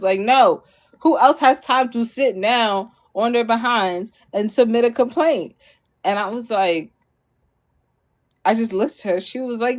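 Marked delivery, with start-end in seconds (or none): 0.2-5.5 upset mom. Retold or imitated. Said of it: "she was that upset about the complaint".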